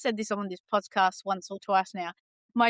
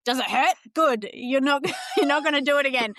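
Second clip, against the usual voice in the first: mocking daughter's bluntness; 4 semitones higher, 4 dB louder than usual. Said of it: "but she's like".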